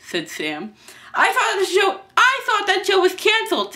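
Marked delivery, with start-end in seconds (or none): 1.1-3.7 high-pitched, loud objection; dismayed protest. Acted. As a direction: object in a panicked squeal.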